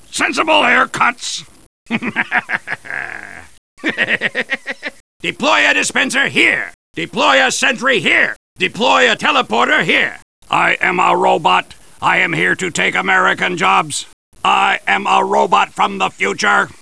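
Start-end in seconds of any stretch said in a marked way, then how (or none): 1.9-3.2 soft staccato chuckling; hammed-up run of quieter laughs; chuckle. Character laughs. 10.5-16.7 monotone pretend robot; mock robot.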